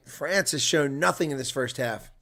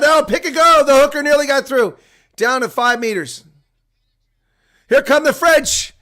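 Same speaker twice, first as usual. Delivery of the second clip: much higher, louder shouting commentary; roaring Allez les Bleus call; frenzied play-by-play; 12 semitones higher, 10 dB louder than usual. Real, not acted. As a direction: scream the attack home. Frenzied call as France near line.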